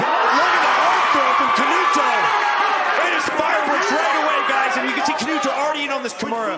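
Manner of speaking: high, loud, fast excited call; erupting with excitement; excited shout. Real, not acted. Explosive action, commentator erupts.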